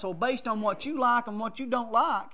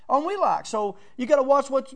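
ordinary talk

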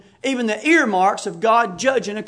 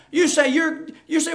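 ordinary delivery

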